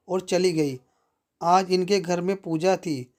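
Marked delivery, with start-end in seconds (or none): none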